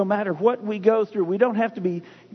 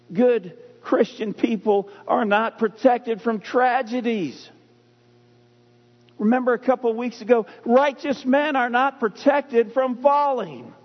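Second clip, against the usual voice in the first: preaching; 4 semitones higher than usual. Acted. Pastor pressing a hard truth on the congregation, preaching. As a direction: drive each phrase home to the church.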